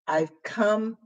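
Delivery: ordinary talk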